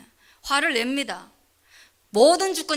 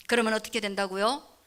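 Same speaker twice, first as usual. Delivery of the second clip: normal talking voice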